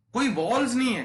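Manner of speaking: high, loud insistence; excited emphatic teacher. Real, not acted. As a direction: insist there are no walls. Emphatic point.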